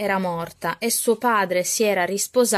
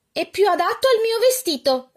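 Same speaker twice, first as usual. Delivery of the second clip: high, loud, peevish snap back; retorting stepsister; 11 semitones higher, 4 dB louder than usual. Acted. Arabella fires back at her sister in a high, loud voice. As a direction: bicker back in a high put-on girl's voice. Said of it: "ribatteva Arabella".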